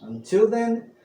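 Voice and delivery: loud, bright-eyed storyteller welcome; booming minstrel introduction; bardic host greeting. Acted. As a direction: hail the traveler like a tavern bard. Storyteller persona outro and sign-off.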